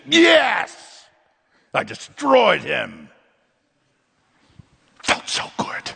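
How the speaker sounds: high loud triumph then slow smug; gleeful gloat fading to a hushed sigh; mock gloating. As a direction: crow over the smart remark. Gloating imagined remark.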